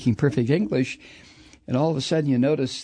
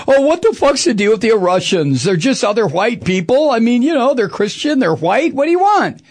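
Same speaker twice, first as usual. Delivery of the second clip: a hint of an exasperated American, voice raised; mock exasperated public; 7 semitones higher, 4 dB louder than usual. Mocking Americans who shrug off the Russians, exasperated. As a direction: toss it off like a fed-up guy at a bar. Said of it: "a lot of people in this country now are going".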